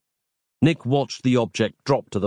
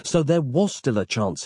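plain reading voice

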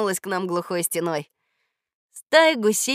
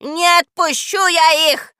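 much higher and louder, slow furious howl; screeching; 8 semitones higher, 9 dB louder, slower than usual. Acted. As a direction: scream your refusal. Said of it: "завопила Баба Яга".

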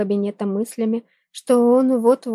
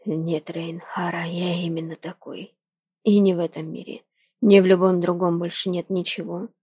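low, hushed lover's voice; a low intense confession; male character voice; 3 semitones lower than usual. Acted. Jordan's voice in the audiobook.

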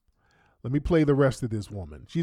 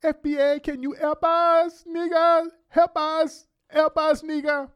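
mock pleading; 12 semitones higher than usual. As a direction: beg in a high mocking voice. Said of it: "when they colonize your ass again".

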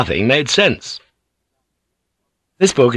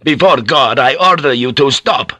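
forceful acted shout, every word weighty; commanding shout; 5 semitones higher, 4 dB louder than usual. A narrator's reading. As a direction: shout to halt the fight. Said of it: "came to his senses and shouted".

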